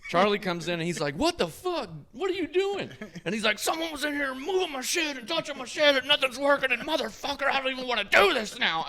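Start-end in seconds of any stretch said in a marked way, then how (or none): 1.2-2.9 imitated high-pitched disbelieving yell; alarmed outcry. Son walks in on father's outburst. 3.6-8.8 high, loud enraged tirade, imitated; furious rant. Tattooer rants at his son.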